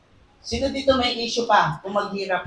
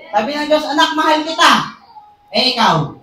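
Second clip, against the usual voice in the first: louder, higher, quick calls of God's love; preaching; 6 semitones higher, 6 dB louder, faster than usual. Acted. Preacher voicing God to a child, loud and quick. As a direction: punch each line, then answer back.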